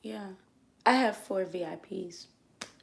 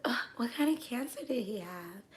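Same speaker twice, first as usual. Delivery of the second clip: much softer, careful and polite; tactful question; 3 semitones higher, 11 dB quieter than usual. Retold or imitated. Dancer gently asking the customer about his illness. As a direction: ask it gently, not nosy. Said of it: "I didn't say it like that, though".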